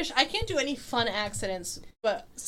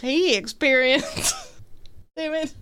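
put-on Southern accent; 3 semitones higher, 5 dB louder than usual. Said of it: "Your voice is so southern".

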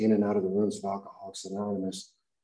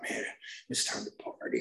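whispered, much lower thought; inner whisper; 9 semitones lower, 10 dB quieter than usual. Retold or imitated. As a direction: hush it to yourself. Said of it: "And I thought".

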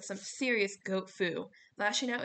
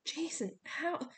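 higher-pitched stunned question; Piper, stunned; 6 semitones higher, slower than usual. A narrator's reading. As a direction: ask it in astonishment. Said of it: "Piper was so stunned".

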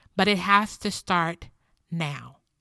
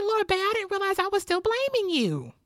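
high-pitched retold apology to a husband; apologetic confession; 12 semitones higher than usual. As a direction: pitch it way up, confessing to your partner. Retelling her apology to husband.

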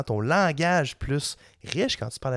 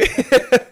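sharp loud burst of laughter; 13 semitones higher, 12 dB louder than usual. Real, not acted. Laughing at joke.

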